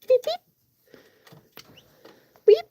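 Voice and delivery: very high playful beeping; squeaky beep. Real, not acted. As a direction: chirp it high like a toy. Squeaky noise at chickens.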